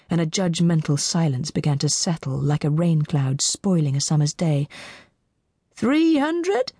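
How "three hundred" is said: high, hopeful auctioneer call. A narrator's reading. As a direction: lift it way up, hoping for a bid.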